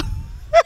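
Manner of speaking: short high-pitched laugh; a bright burst of laughter. Real, not acted. Laugh after intro.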